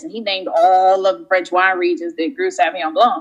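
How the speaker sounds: usual speaking voice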